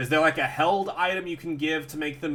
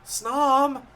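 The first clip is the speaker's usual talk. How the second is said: excited cheer; 9 semitones higher, 3 dB louder than usual. Real, not acted. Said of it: "we just need to be, be very affectionate, very excited".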